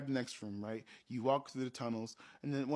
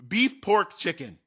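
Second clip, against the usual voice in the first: announced with flair; 6 semitones higher, 11 dB louder than usual. Retold or imitated. GM lists smells with flair.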